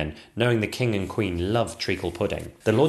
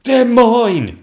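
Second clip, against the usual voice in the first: high, loud grabbing cry; snatch the prize; greedy claim; 13 dB louder than usual. Acted. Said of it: "said the Lord Chamberlain".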